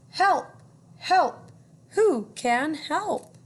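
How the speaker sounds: high, loud desperate cries for help; duck crying for help. Acted. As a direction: call out like a panicking stuck duck. Duck calling for help in story.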